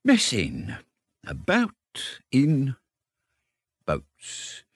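very slow, drawn-out reverie with long pauses; dreamy. A narrator's reading. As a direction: let each word float away. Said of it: "he went on dreamily".

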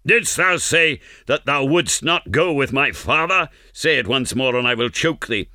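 high-pitched threatening interrogation; simulated fury. A narrator's reading. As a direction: menace a man held by the throat. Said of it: "in simulated fury".

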